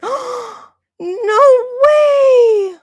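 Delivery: high, loud squeal of disbelief; gasping cry of shock. Real, not acted. Streamer screams at a surprise donation, shrieking.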